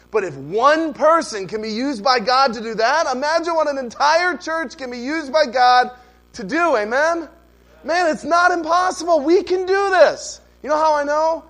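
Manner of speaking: rising, loud and high, rallying the church; preaching at full force. Real, not acted. Pastor rallying his congregation to outreach, ringing and loud. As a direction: build to a ringing preacher's cry.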